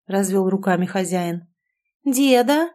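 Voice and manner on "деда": drawn-out suspicious question, higher and louder; louder doubtful drawl; distrustful drawl. A narrator's reading. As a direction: stretch it out in doubt. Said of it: "недоверчиво протянула внучка".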